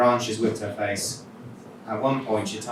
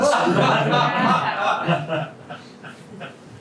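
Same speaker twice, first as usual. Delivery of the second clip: high burst of real laughter; 14 semitones higher, 4 dB louder than usual. Real, not acted. Reader laughs at her own story, real laugh.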